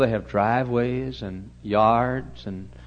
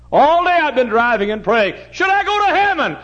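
high, loud preaching cry; impassioned preaching; 12 dB louder than usual. Acted. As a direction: lift the whole line at full force.

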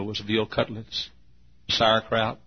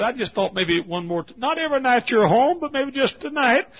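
high-pitched, louder motherly plea; pleading mother; 5 dB louder than usual. Retold or imitated. Mother asking her soldier son for bedtime prayer, acted high plea. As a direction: play the mother's wish, climbing high and insistent.

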